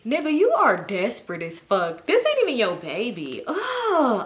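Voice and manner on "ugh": high, exasperated "ugh"; annoyed groan. Retold or imitated. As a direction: let out an exasperated groan, pitched up. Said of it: "I was so annoyed".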